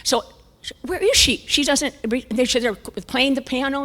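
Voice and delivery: normal talking voice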